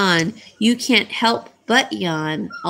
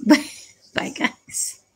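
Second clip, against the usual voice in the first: ordinary talk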